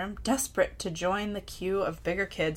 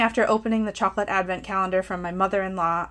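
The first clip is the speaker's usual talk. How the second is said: ordinary delivery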